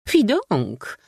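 high outraged cry; indignant. A narrator's reading. Curious lady outraged at the parasite, high indignant cry. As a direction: recoil in disgust, high. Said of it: "oburzyła się ciekawa dama".

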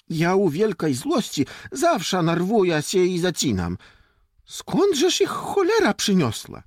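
high, loud, heated self-defence; indignant boy. Acted. Boy excuses his stammering to friends, indignant. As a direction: protest crossly, pitch soaring on the curse.

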